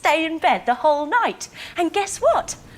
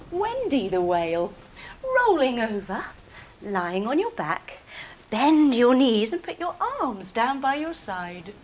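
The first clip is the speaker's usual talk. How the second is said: ordinary talk, nothing marked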